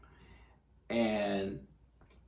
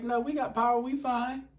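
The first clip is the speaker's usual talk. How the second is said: lightly voiced reply. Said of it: "I was like".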